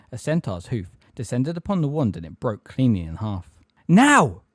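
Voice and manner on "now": sudden high, loud shout; roar. A narrator's reading. Centaur hauling Harry up, sudden roar. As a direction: roar it right in their ear. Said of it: "roared a voice in Harry's ear".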